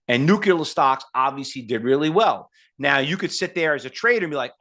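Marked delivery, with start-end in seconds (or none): none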